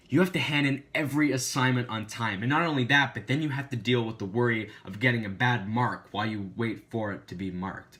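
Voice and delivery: loud, punchy script delivery; presenter read. Acted. Reading the script aloud.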